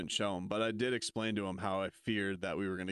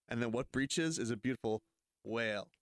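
usual speaking voice

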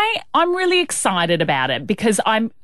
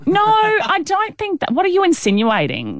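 mock offended; 9 semitones higher than usual.

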